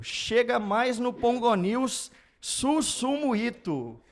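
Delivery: high, slow drawn-out guest announcement; slow announcer call. Shown as a demonstration. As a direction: stretch out the name like a host. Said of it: "que vai chamar o nosso convidado".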